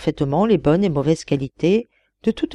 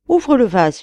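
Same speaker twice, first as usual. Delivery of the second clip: high, louder narrated plea; pleading; 7 semitones higher, 5 dB louder than usual. A narrator's reading. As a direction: beg the fisherman, voice raised. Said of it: "n'épargna rien pour tâcher de toucher le pêcheur". (supplication lue d'une voix plus haute)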